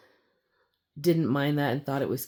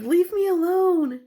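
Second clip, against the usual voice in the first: put-on annoyed kid; 13 semitones higher, 5 dB louder than usual. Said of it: "they're just like".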